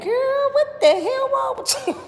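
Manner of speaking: very high, loud incredulous squeal; hammed-up shocked reaction to a friend; mock disbelief. Acted reaction to Jag's outburst.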